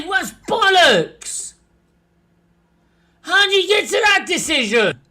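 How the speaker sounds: angry. Real, not acted. Ranting at referee.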